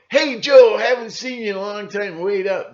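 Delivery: mock cheery hail. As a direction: call out to an old pal with fake cheer. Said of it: "Or they'll go".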